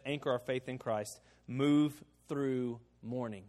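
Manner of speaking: usual speaking voice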